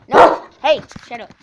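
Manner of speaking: sharp loud yell; shouting at dog. Real, not acted. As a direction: bark a command at the dog. Yelling at the barking dog.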